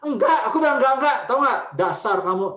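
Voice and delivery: put-on mother yelling aggressively at her child. Shown as a demonstration. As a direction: yell at the kid. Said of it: "itu sebenarnya bukan tegas. Itu tuh agresif".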